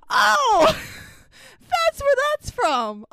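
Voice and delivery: high, loud, drawn-out revelation; astonished realization. Retold or imitated. Viewer finally learning where the catchphrase came from, acted-out astonishment. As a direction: let it dawn in a big high cry. Re-enacts sudden realization of origin.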